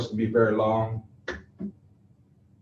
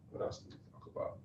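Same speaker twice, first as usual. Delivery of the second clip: quick whisper to himself; whispered aside; 22 dB quieter, faster than usual. Real, not acted. Trainer forgets a point mid-talk, whispering to himself quickly. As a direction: drop to a hushed whisper, asking yourself.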